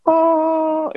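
high, sustained single-note chime; imitated MacBook chime. Podcaster showing the MacBook turn-on sound, imitated chime. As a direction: hold one high "oh" like a startup tone. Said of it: "that sound a MacBook makes".

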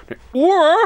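shrieked high and loud: "Eine Uhr?"; mock outrage shout; shouted question. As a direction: yell "Uhr" in disbelief. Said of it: "Schrei mich nicht so an".

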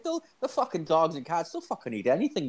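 lightly hammed-up Irish drinker voice; old Irish guy. Imitating Tommy the old Irish drinker, high-pitched.

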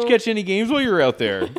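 chirpy Canadian small talk; Canadian officer. Pretend. Canadian officer chatting up traveller, chirpy Canadian accent.

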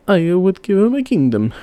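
a king offering everything at once; king, eager. A narrator's reading. King answers the old man.

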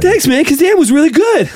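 high breathless star impression; Mark Wahlberg. Actor thanking Shaq, breathless Wahlberg voice. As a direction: thank him in a winded, lifted voice. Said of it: "You're gonna go Wahlberg".

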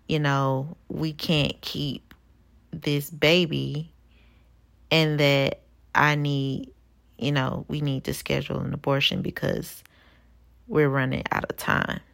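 usual speaking voice

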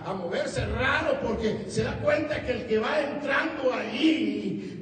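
emphatic, drawn-out sermon cadence; preaching. Acted. Preacher telling how the demon recognizes Christ, fervent preaching. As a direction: build it up for the congregation, stretching key words.

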